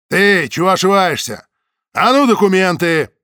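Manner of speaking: bully him for his papers; guard barking at a stranger for documents; rough demand. A narrator's reading.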